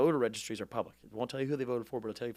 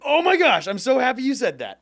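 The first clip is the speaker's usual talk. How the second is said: very high, loud burst of excitement; thrilled, squealed-up exclamation; real delight; 13 semitones higher, 13 dB louder than usual. Real, not acted. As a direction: explode with joy at the question. Delighted at host's remark.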